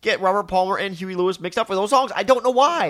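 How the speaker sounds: high, loud protest at oneself; exasperated. Real, not acted. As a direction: plead your case, baffled by your own mistake. Exasperated at own repeated name mix-up.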